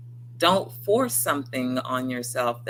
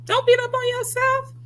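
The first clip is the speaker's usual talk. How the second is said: squeaky high plea; 5 dB louder than usual. Pretend. Sudden high voice.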